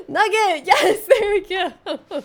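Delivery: high laughing cheer; excited cheer. Real, not acted. Cheering a nugget drop.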